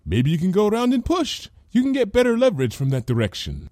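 carefree, high-voiced suggestion; unworried. A narrator's reading. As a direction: offer it lightly, without a care. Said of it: "without the faintest hint of worry".

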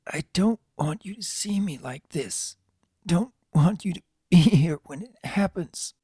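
high, weak, breathless colt; panting. A narrator's reading. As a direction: pant the words out through illness. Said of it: "before lowering it to speak, panting".

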